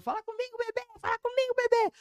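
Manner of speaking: very high, squeaky sing-song call; mock high-pitched chant; playful high squeal. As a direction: squeal it like friends in the car. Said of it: "a gente ficava falando isso no carro".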